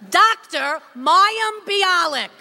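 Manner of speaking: slow, loud name call; announcer voice. Acted. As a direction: announce her name grandly. Announcer introducing cast.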